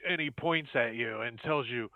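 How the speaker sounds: normal talking voice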